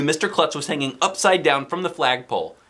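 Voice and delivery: plain reading voice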